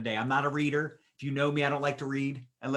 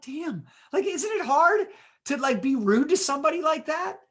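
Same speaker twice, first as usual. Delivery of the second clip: baffled disbelief; 12 semitones higher, 4 dB louder than usual. Retold or imitated. Critic left baffled by kindness.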